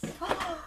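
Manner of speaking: soft, high gasp of surprise; delighted gasp. Real, not acted. Crafter spotting a little set of pastels, delighted gasp. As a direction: suck in breath with delight. Sees new item in haul.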